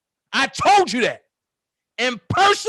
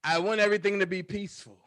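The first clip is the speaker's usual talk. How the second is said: lichte Trump-imitatie, iets zachter en sneller; Donald Trump voice; 5 semitones lower, 5 dB quieter, faster than usual. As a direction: doe losjes Trump na, zogenaamd vredelievend. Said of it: "In my Donald Trump voice".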